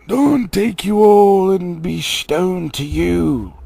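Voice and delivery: slow, loud, strange high voice; eerie mimicked voice. Wizard's voice cursing the trolls at dawn. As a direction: curse them slowly. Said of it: "said a voice that sounded like William's".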